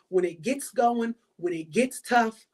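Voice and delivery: usual speaking voice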